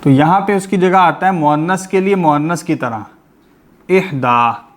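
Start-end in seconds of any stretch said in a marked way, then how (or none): 3.9-4.7 इहदा with a long "aa" ending; खड़ी ज़बर. Shown as a demonstration. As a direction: hold the final "daa" of इहदा. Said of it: "ये खड़ी ज़बर है". (इहदा के आखिर में खड़ी ज़बर)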